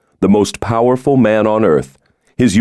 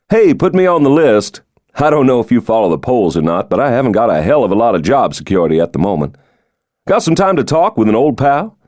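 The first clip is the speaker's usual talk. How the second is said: higher-pitched, jovial, confident politician; genial president; 4 semitones higher than usual. Acted. The president jokes to his old roommate, jovial and higher. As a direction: play the charming president joking about his security.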